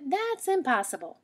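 mother rabbit saying it cannot happen; firm mother. Acted. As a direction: end the discussion like a mom.

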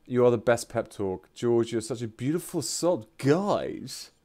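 usual speaking voice

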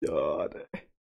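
groggy hungover groan; drained, aching head mumble. Real, not acted. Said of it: "Boah, mein Schädel".

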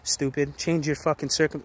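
usual speaking voice

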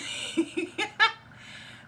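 high breathy giggle; giggling laugh. Real, not acted. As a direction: burst into giggles. Laughing at own accent.

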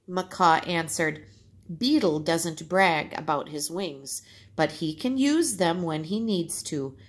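put-on lively read-aloud for children; story narration. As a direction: tell the tale to the class, bright and clear. Teacher reads folk tale aloud.

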